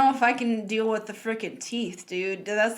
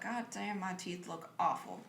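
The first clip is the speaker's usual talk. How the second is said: muttered dismay; 4 semitones lower, 15 dB quieter than usual. Retold or imitated. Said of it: "be like".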